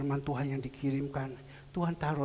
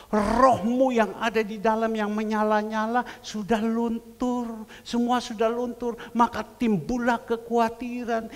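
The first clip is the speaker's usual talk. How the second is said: loud, high impassioned sermon voice; preaching at full force; 10 semitones higher, 7 dB louder than usual. Acted. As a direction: preach it out to the congregation. (berkhotbah dengan suara keras dan berapi-api)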